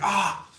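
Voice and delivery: short, high yelp of pain. Retold or imitated. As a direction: yelp like a burnt hand on hot metal. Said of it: "he's like".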